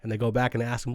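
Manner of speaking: ordinary talk, nothing marked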